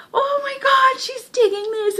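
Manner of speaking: high, giddy squeal; mock excitement. Retelling the boy seeing the girl's happy look, hammed-up giddy squeal. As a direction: squeal it like a thrilled anime character. Acting out boy's delighted realisation.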